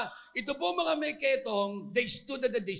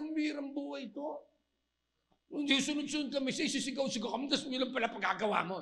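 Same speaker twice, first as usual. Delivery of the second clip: mock griping; 4 semitones higher, 3 dB quieter, faster than usual. Acts a leper griping at Jesus.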